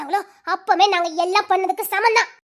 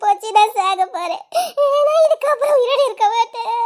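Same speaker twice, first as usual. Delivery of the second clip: wailing; 4 semitones higher than usual. Acted. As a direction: wail it out through sobs. எதுக்கு இப்படி உட்கார்ந்து கத்திட்டு.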